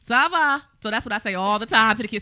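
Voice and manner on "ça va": loud, high French catchphrase; attempted French accent. Shown as a demonstration. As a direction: call it out in amateur French. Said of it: "I try to say it with a little accent".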